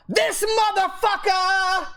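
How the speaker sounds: a high, hammed-up outraged yell, dragged out slow; mock angry rant. Streamer mocks a salty player whining after a loss, shrill mock rage. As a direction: ham up a sore loser's furious outburst. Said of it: "come to me right after the game".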